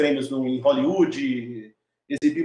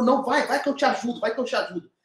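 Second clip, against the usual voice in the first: higher-pitched, eager urging of a friend; retold excitement; 6 semitones higher, faster than usual. Screenwriter urging him to make the film, excited. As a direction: imitate someone excitedly offering help. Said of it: "ele ficou super empolgado".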